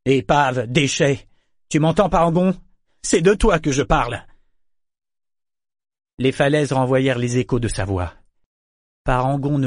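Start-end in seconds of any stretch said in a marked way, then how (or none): 0.1-4.2 outraged, raised-pitch insult. A narrator's reading. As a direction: raise your voice and taunt someone silent. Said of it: "Alors il haussa le ton".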